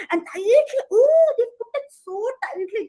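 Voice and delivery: high, marvelling squirrel voice; amazed squirrel. Acted. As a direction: squirrel watches monkeys pack stones, high and amazed.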